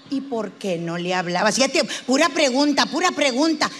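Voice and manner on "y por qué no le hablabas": low-pitched therapist question; probing psychologist. Acted. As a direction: drop low and ask with studied calm.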